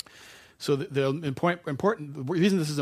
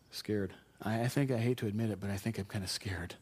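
a soft, sheepish confession; quiet admission; 4 semitones lower, 7 dB quieter than usual. Retold or imitated. A guy confessing to the congregation, softly and reluctantly. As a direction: admit it under your breath.

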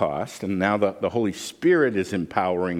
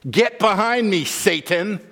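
higher, louder rebuke, sharp and commanding; stern rebuke; 8 semitones higher, 4 dB louder than usual. Acted. Jesus rebuking Peter to his face, with a loud stern command. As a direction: cut him off hard, like casting out Satan.

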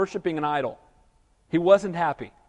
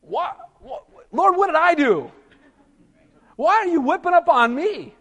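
higher put-on pleading whine; mock complaining; 7 semitones higher, 3 dB louder than usual. Moses complains to the Lord, higher mock whine. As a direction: complain to God like a fed-up pastor. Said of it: "He starts complaining".

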